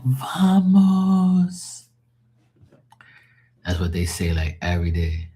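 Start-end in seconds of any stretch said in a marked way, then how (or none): 0.0-1.9 high drawn-out "Vamos!" rallying call; mock footballer urging teammates on; a player pumping up the team, much higher and drawn out; imitating a footballer's cry. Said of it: "they're like".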